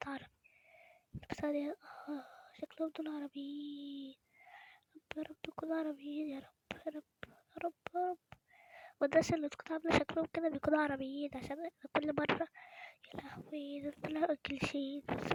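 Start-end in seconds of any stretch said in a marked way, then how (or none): 2.1-8.2 whispered then murmured pleading; hushed prayer. Real, not acted. Vlogger hoping the strangers speak Arabic, hushed whisper. 13.2-13.8 breathless hushed oh no; whispered dismay. Real, not acted. Vlogger realising they are English, whispered dismay.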